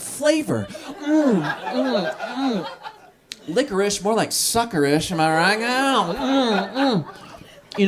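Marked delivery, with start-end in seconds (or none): 1.0-2.7 rising mm mm of savoring licorice; mock sucking sounds. Comic picturing himself in public sucking licorice, savoring hums. 5.6-7.0 rising mm mm of savoring licorice; mock sucking sounds. Comic picturing himself in public sucking licorice, savoring hums.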